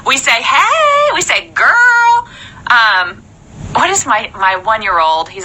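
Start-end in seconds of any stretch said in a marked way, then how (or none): 0.4-1.1 animated greeting. Retold or imitated. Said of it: "Rachel and I, we're very animated". 1.5-2.3 put-on high, loud "Girl" to a friend; animated "girl". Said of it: "Rachel and I, we're very animated".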